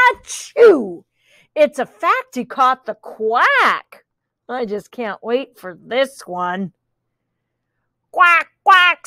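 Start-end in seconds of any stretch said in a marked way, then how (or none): 0.1-1.0 loud achoo; dog sneeze. Acted. Reading aloud, the dog sneezes. 8.1-9.1 loud, higher quacks; quacking dog. Acted. The dog quacks for the child. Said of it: "said the dog".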